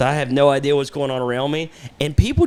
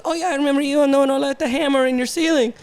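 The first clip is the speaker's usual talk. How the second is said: higher, fast friendly voice; imitating the worker; 11 semitones higher than usual. Worker greeting the speaker months later, higher and fast. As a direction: rattle it off cheerfully in a higher voice. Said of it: "he goes".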